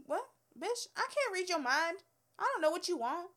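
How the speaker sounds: fed-up outburst at the customer; exasperated. Real, not acted. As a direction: vent the frustration.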